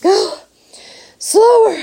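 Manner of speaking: high, loud gasps between words; panting. A narrator's reading. Winded mother begs Wonka on the stairs, panting hard. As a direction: gasp it out, badly winded. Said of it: "panted Missus Salt".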